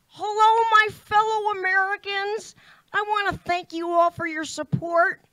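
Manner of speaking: asked to say something, does a loud Sarah Palin impression. Shown as a demonstration. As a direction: address the crowd like a folksy politician. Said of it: "Can you say something?".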